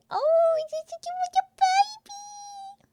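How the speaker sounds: squeaky adoring gush. Real, not acted. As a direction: coo at the little bunny. Gushing over bunny sticker.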